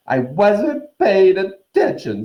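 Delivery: high, loud, slow theatrical ghost; dramatic. Acted. Myrtle answering Harry's question with melodrama. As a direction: drag each word out for effect. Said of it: "said Myrtle dramatically".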